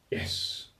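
whispered inner cheer; hushed, voiceless yes to yourself; private little yes. Retold or imitated. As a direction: celebrate secretly under the breath. Silent hopeful thought to himself.

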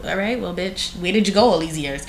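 soft, hushed imitation of the father; mock dad. The father to the mother about the baby, hushed. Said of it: "he was like".

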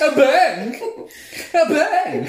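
loud, high mimicry through laughter; ridiculous waiter voice. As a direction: squawk it in a silly high voice. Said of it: "he's got the most ridiculous voice".

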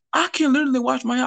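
normal talking voice

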